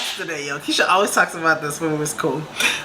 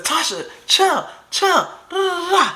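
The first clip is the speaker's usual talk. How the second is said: imitated urgent calming; 10 semitones higher than usual. Boyfriend urging her to calm down.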